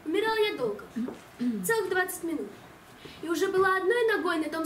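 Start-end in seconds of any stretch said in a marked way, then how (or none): none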